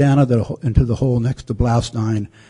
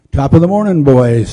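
thick Irish brogue; 2 semitones higher, 4 dB louder than usual. Retold or imitated. Chaplain greeting his Irish soldiers, in a thick Irish brogue. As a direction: hail the troops in a broad Irish brogue. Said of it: "in his thickest Irish brogue".

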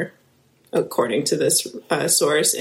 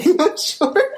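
laughing; 11 semitones higher than usual. Real, not acted. Laughs at not knowing.

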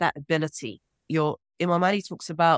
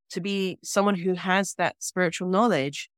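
usual speaking voice

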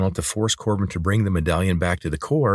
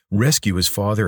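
ordinary delivery, nothing marked

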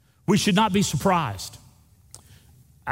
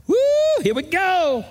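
high, eager whoop; excited; 3 dB louder than usual. Retold or imitated. Pastor showing the joyful outlook, high excited whoop. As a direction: whoop it with glee. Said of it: "shift back to that".

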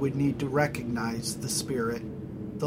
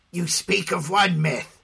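scoffing; 3 semitones higher, 4 dB louder than usual. Acted. Corrado scoffs.

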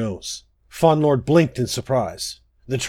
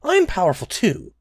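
higher-pitched little creature voice; small butterfly interrupting; 6 semitones higher than usual. A narrator's reading. Tiny butterfly interrupts the boasting fawn lord, high and insistent. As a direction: pipe up, high and undaunted. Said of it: "Another voice interrupted".

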